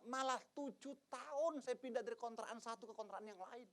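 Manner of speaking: normal talking voice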